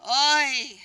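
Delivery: put-on a touch of an old Yiddish accent; lightly voiced Yiddish grandmother. Old Yiddish grandma playing a role.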